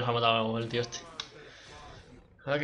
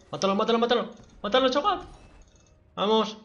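excited urging; 7 semitones higher than usual. Real, not acted. Urging teammate to kill enemy.

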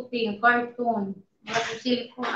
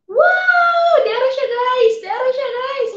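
much higher, louder cry of real delight; excited shout; 13 semitones higher, 9 dB louder than usual. Real, not acted. Vlogger showing viewers the opened earbud case, excited shout. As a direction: shout it out, thrilled by the reveal.

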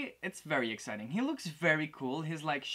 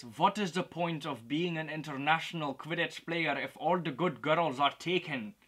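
put-on shaky Russian accent drifting into Irish; badly faked Russian accent for a quote; attempted Russian accent; 4 dB louder than usual. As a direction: try a Russian accent and let it slip toward Irish. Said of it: "trying to do a Russian accent".

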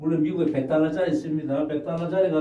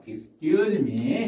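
very slow and clear; slow model phrase; 3 semitones higher, much slower than usual. Shown as a demonstration. Teacher modelling a polite opener slowly. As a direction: say it slowly for learners. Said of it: "teacher models phrase".

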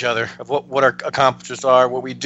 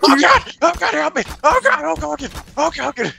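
high panicked cries to God; terrified gibbering. Acted. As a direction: babble in terror, pitched way up. Screaming in panic during game.

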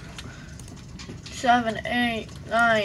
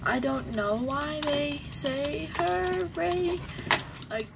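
ordinary talk

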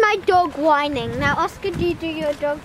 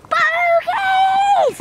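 high, loud drawn-out yell; shouting; 11 semitones higher, 6 dB louder than usual. Shown as a demonstration. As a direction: shout it out to embarrass someone. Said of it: "you're, like, shouting".

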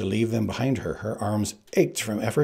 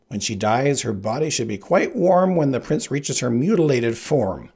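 higher, louder gloating plotter; scheming Sicilian; 4 semitones higher, 5 dB louder than usual. Acted. Sicilian gloating over his plan, high and loud.

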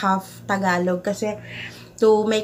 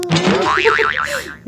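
high delighted laugh; bright happy giggle; giddy laugh; 8 semitones higher, 4 dB louder than usual. Real, not acted. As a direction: laugh out of sheer joy. After gift reaction.

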